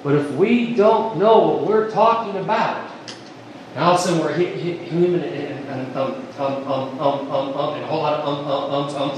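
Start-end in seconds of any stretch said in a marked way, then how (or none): 4.2-9.1 low, soft string of ums; stuttering and stammering. Acted. Preacher mimicking an unprepared witness, stammering. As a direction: stumble and mumble through filler words. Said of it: "sitting here stuttering and stammering".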